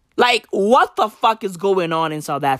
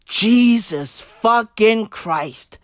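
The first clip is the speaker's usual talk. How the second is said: drawn-out exasperation; much slower than usual. Acted. Exasperated exclamation.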